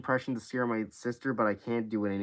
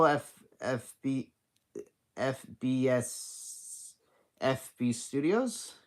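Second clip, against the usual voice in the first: slow reading; much slower than usual. Real, not acted. Squinting to read a chat name.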